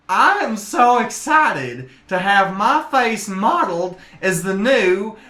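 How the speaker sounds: slow, high-pitched, drawn-out delivery; Arnold Schwarzenegger-like colonel. Acted. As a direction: play a gushing military colonel slowly. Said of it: "that's what he sounds like".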